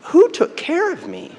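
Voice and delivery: high-pitched imitation of a sad child; just sad. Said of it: "no fear, no anger, just sad".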